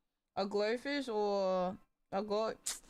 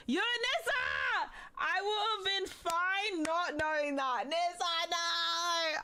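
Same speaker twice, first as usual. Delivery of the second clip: high, slow cry of protest; dismayed wail; 6 semitones higher, 3 dB louder, slower than usual. Real, not acted. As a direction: wail it out, stretching the words. Upset at bad news from friend.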